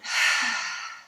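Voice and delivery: long audible sigh out; breathy yoga exhale; demonstrated exhale. Shown as a demonstration. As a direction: let the breath out through the mouth. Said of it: "letting it out".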